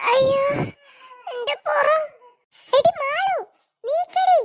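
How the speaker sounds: sobbing plea; crying in pain. Acted. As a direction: beg her off your back. Crushed under sister, in pain.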